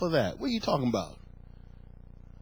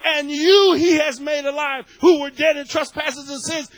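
raised, forceful recitation; worked-up scripture reading; 4 semitones higher, 4 dB louder than usual. Retold or imitated. Preacher reliving his discovery in chapter two, worked up. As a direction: proclaim the verse high and loud, excited. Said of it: "I'm getting all worked up".

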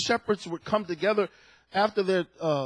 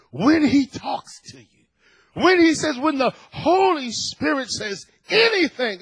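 ordinary talk

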